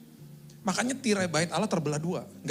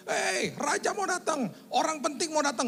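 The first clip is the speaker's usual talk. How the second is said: herald's cry; 12 semitones higher than usual. Retold or imitated. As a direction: call out the king is coming. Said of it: "lari ke dalam kota teriak".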